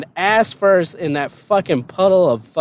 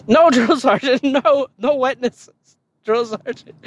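laughing denial; 9 semitones higher than usual. Retold or imitated. Recruit laughing, lying.

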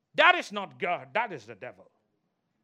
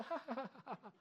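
imitated tickle laugh; 4 semitones higher, 10 dB quieter, faster than usual. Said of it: "If you're ticklish, you'll be".

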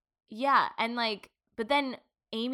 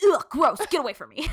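put-on high, louder grossed-out teen girl; disgusted teen girl; 6 semitones higher, 9 dB louder than usual. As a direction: recoil like a mean high schooler. Mocking high school girls.

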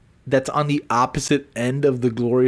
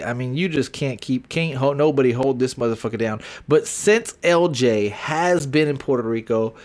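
ordinary talk, nothing marked

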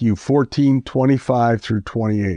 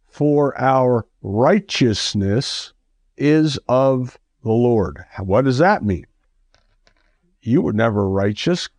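ordinary delivery

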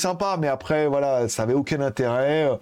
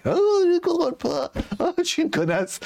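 much higher mock lament; mock dramatic heroine; 14 semitones higher than usual. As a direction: ham up a whiny heroine losing control. Continues mocking the heroine.

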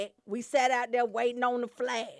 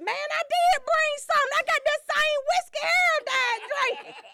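much higher, put-on voice of a proud guest; mock guest; 13 semitones higher, 5 dB louder than usual. Guest at the funeral brags about his whiskey, high put-on voice. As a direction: squeak it out like a guest bragging about cheap whiskey. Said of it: "And then they be like".

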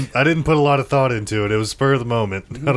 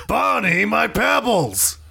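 high, drawn-out cartoon cry; high mock lament, last word stretched; hammed-up cartoon wail; 9 semitones higher, much slower than usual. Player voices joke caveman character.

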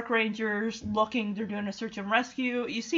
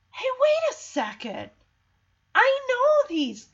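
high, excited acted realization; sudden recognition; 13 semitones higher, 4 dB louder than usual. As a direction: imitate someone suddenly placing them, pitch jumping up. Acts lady suddenly recognizing animals.

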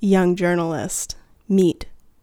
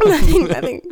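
laughing; 8 semitones higher, 7 dB louder, faster than usual. Retold or imitated. Echoing guilty kid bit.

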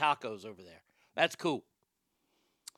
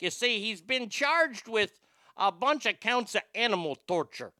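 hammed-up Chicago accent; put-on Chicago accent, storytelling. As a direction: tell it like a Chicago know-it-all. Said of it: "do the Chicago accent like that".

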